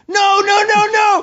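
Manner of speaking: high-pitched retold panic scream. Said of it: "and I'm screaming".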